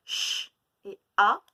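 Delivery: Ch et a dits lentement, bien appuyés; étirer chaque son pour l'enfant; sons lents et détachés. Shown as a demonstration. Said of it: "je vous décompose le mot en sons". (sons étirés)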